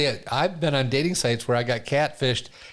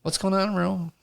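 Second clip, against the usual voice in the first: bright high chat-room hello; eager newcomer saying hi; cheery greeting; 6 semitones higher than usual. Retold or imitated. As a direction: call out cheerfully to the whole room. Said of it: "and I'm like".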